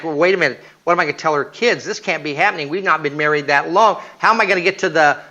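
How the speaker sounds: fast, rushing worried thoughts; panicked racing thoughts. Retold or imitated. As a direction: race through the fears. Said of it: "in my head I'm going".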